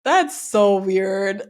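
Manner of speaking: ordinary talk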